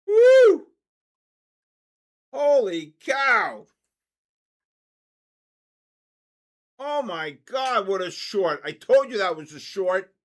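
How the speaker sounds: loud, high, slow cries at the drop; real thrill watching the Nasdaq plunge; excited shouting. Real, not acted. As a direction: yell it out as the numbers fall. Cheering the short live.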